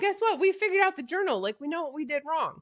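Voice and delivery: high-pitched retold excitement; imitating an eager friend breaking news; excited discovery. Retells character's excited discovery.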